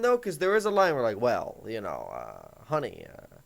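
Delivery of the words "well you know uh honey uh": much lower, smug, detached calm; condescendingly unbothered, brushing her off; smug calm reply to an angry girlfriend; douchebag calm. Shown as a demonstration. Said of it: "don't be that douchebag calm".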